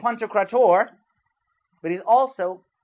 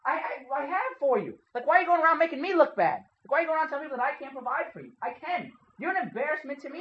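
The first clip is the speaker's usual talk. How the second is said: higher, pained rebuke, faster on the second why; hurt parent; 6 semitones higher, 3 dB quieter than usual. Retold or imitated. Father scolds his son for shaming him, hurt and angry. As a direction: scold the boy for making you look bad. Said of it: "is what I would say to him".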